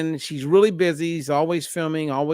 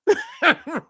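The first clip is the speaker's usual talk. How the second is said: high laugh. Real, not acted. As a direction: burst of high-pitched laughter. Laughing at the client's confession.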